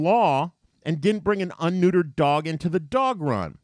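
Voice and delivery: retelling a furious yell at a stranger. Retold or imitated. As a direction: let her have it, voice climbing. Getting furious now.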